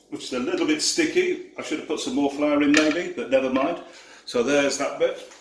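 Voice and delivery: ordinary talk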